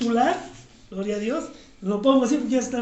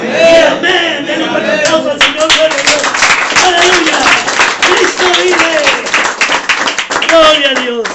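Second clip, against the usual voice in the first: much louder, calling out praise; exultant shouting; 15 dB louder than usual. Real, not acted. Pastor calls the congregation to applaud the Lord, shouting. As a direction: rouse the church to cheer and applaud.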